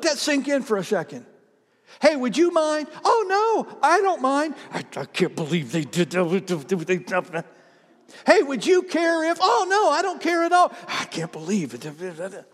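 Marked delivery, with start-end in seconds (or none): 2.0-4.5 high, over-sweet mock politeness; fake agreeableness. Acted fake agreeable exchange. 4.7-7.4 stuttering grumble under the breath; mock grumbling. Acted grumbling behind their back. 8.3-10.7 high, eager mock politeness; fake agreeableness. Second fake agreeable exchange. 10.9-12.4 softer, slow muttered complaint; mock grumbling. Second acted private grumble.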